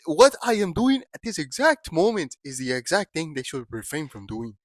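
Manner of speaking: slow, low mock African accent; softly deliberate put-on African accent. Host warning about being canceled, mock African accent. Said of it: "I can do this accent".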